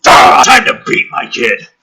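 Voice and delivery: loud hammed-up shouted joke; mock shout. Comedian mocking the dad's intent, mock shout. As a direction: bellow the dark joke.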